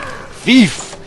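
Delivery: high, loud shout of thief; hammed-up alarm of a startled guard; yelling guard impression. Mimicking the NPC who screams at the thief. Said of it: "he's like".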